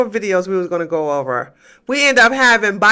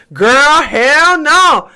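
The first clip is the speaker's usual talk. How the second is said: emphatic refusal; 5 semitones higher, 5 dB louder, much slower than usual. Real, not acted. Refusing the idea of moving to Texas.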